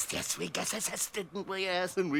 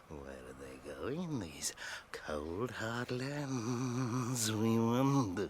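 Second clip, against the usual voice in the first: brooding murmur; 6 semitones lower, 5 dB quieter, slower than usual. Acted. Gollum muses darkly.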